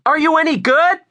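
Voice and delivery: loud, very high woman's yell; yelling back. A narrator's reading. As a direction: yell it back across the street. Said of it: "she yelled back".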